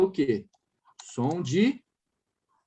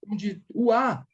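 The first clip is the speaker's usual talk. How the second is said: inner aha; 3 semitones higher, slower than usual. Retold or imitated. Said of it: "ele vai saber".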